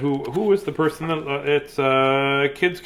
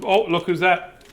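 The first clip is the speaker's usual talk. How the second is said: ordinary delivery, nothing marked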